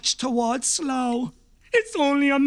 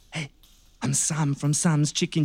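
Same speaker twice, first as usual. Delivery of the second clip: usual speaking voice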